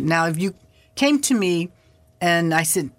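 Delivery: ordinary talk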